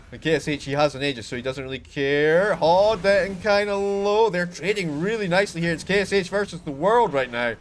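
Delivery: fast, rising caster hype through the fight; excited esports caster calling a clash; play-by-play. Acted. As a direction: call the fight live as it unfolds. Live casting a team fight.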